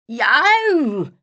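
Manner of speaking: sudden startled yelp. A narrator's reading. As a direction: yelp out in shock. Character yelps when woken.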